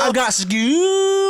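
very slow, high, loud whining; whiny kid voice. Pretend. Host mimicking a whining kid all day, slow high whine. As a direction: drag out school like a whining kid. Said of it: "not funny".

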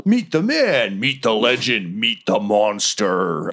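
showy promoter's slogan, drawn out at the end; hype announcer. Acted. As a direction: stretch it out like a fairground billing. Mimics celebrity booth hype.

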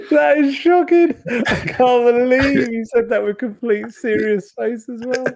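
high-pitched, laughing outburst; amused disbelief. Real, not acted. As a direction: crack up in mock outrage at a joke. Cracking up at co-host's deadpan line.

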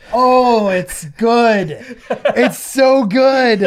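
delighted laughing approval; laughing, impressed and amazed. Real, not acted. Sleeve survives the stretch test.

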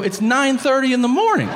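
high, loud, outraged comeback; indignant protest. Retold or imitated. Patient protesting to doctor about being fattest all day, indignant.

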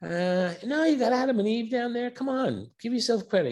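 God waving off Peter's praise, casually. Retold or imitated. God answers Saint Peter, lightly voiced and casual. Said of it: "God said".

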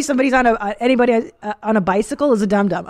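ordinary delivery

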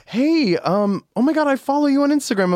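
excited fan spotting a host, gushing high. Retold or imitated. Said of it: "people that were like".

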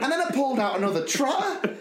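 amused retold horror; laughing retelling. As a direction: rush it out while laughing. Quoting her piglet story.

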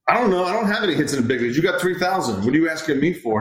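mock-incredulous coach answering his star; retold exasperated reply. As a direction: toss it back fast and high.

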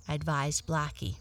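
plain reading voice

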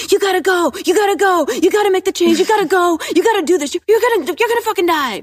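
frantic high-pitched shout, repeating urgently; loud, glaring, insane ego voice; loud, manic inner voice. Acted. Guest voices the panicked voice in our head, manic shouting. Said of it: "really loud and glaring".

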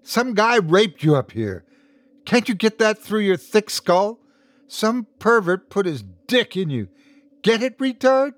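high, scornful, angry taunt; baiting, humiliating. A narrator's reading. Woman trying to humiliate sense into him, high baiting. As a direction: taunt him cruelly, pitched high and rising. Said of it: "trying to bait him, to humiliate some sense into him".

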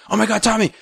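high-pitched mock starstruck pleading; gushing fan. Mimics gushing autograph seekers.

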